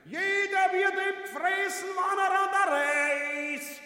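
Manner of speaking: hoch und laut ausgerufen, zum Ende langsamer; lauter Ausruf. Acted. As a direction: es feierlich herausschreien. Predigend-schreiend beim Bärenangriff. (hoch und laut, fast gesungen)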